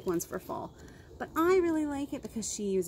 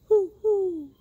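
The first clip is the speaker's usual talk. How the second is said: higher and louder, hooting; owl hoot; 8 semitones higher, 9 dB louder, much slower than usual. Acted. Reading to kids, voicing the owl, loud hoot. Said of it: "called Owl".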